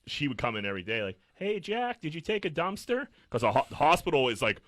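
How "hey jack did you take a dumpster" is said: high, sing-song mock old lady asking Jack; chirpy old lady. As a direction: chirp the question like a nosy old visitor. Old lady visiting each day.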